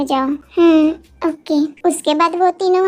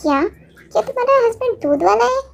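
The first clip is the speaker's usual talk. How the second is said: shocked disbelief; 6 semitones higher than usual. Acted. Aunt shocked at milkman husband.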